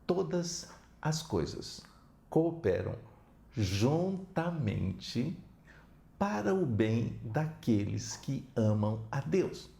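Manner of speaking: very slow, each word set apart; slow scripture recitation. Acted. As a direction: read the verse slowly and solemnly. Quoting scripture slowly.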